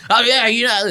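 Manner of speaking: high-pitched, riled-up retort; mock amped-up anger. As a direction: imitate someone getting worked up. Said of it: "like, really amped up".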